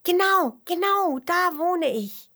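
high, loud excited worm; freudiger Ruf. A narrator's reading. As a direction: shout agreement in a little creature's voice. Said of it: "ruft der Regenwurm". (hohe, begeisterte Wurmstimme)

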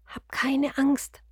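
slow, breathy whisper to a tiny snail. A narrator's reading. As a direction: soothe it in a hush, slowly. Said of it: "flüstert Nils der Schnecke zu".

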